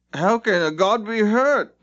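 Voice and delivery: slow, high sceptical challenge; a doubtful geg challenging, slow and high; demanding. A narrator's reading. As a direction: demand it suspiciously. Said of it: "demanded one of the Gegs".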